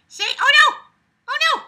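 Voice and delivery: tiny, very high "oh no" squeal; squealed mock alarm. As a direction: squeal it in playful panic. Playful cries while pet bites.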